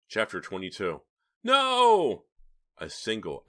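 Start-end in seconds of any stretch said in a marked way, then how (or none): 1.4-2.3 very high loud drawn-out wail; anguished wail. A narrator's reading. Philip seeing Justine run into the fire, anguished wail. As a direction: wail in despair. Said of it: "anguished wail from Philip".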